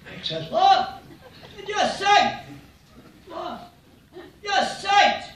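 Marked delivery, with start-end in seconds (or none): 0.5-1.0 high-pitched yelled question; high, louder answering holler; calling back. Acted. 1.7-2.4 high, drawn-out shout up a building site; loud high-pitched holler. Acted. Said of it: "he shouts up". 3.3-3.7 very high, faint call; small high voice from up above; distant calling back. Acted. Worker calls from far above. 4.4-5.3 high, drawn-out shout up a building site; loud high-pitched holler. Acted. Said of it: "he shouts up".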